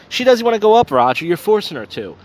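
lightly hammed-up annoyed protest; exasperated retort. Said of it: "I go".

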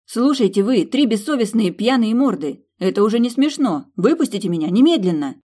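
put-on locked in, shouting at drunk friends; furious shout. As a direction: scream at the pranksters through the door. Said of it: "разозлилась она на подруг".

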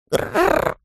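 wordless rolled growl; waterlogged gurgle rolled in the throat; gurgling growl. A narrator's reading. Half-drowned bear tries to speak, gurgling.

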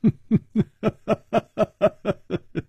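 long, high staccato chuckle; unbothered string of short laughs; light laugh. A narrator's reading. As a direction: laugh it off at length, evenly. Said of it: "楚留香淡淡的说".

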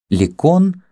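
high, startled cry; frightened cry. A narrator's reading. Kama as the young Greek bursts in, frightened. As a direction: cry out, caught by surprise. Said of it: "в испуге вскрикнула Кама".

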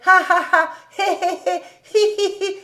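high mocking "ha-ha, hé-hé, hi-hi"; imitating his laugh. A narrator's reading. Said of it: "il imitait la façon de rire de Cobus".

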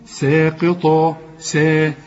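ساقطة with a light thin sa; light seen recitation. Shown as a demonstration. Teacher showing the light seen before qaf. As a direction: keep سا light, not like صا. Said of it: "الأول قول سا".

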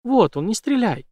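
high frantic plea; frightened plea. A narrator's reading. Jane pleading with the crossbowman, high frightened plea. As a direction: beg him not to shoot. Jane begs him not to shoot.

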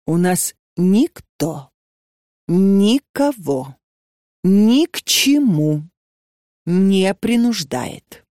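very slow, each phrase set apart; slow deliberate stress. A narrator's reading. Natalya Stepanovna slowly insisting nobody forces anyone. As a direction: lay down every word, slowly and firmly.

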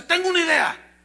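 high, loud burst of inspiration; sudden bright idea. Acted. Wolf tells the trapped deer of his plan, excitedly. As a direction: blurt it out as the eager wolf.

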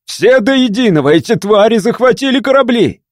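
высокий испуганный крик; кричать в панике, задыхаясь от страха; перепуганный крик. Acted. (испуганный гонец кричит командиру о захвате кораблей)

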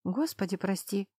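ordinary delivery, nothing marked

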